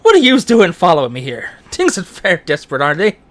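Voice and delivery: Irish character, loud at the start; broad Irish accent, put on for a character. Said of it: "I'm shouting in an Irish accent".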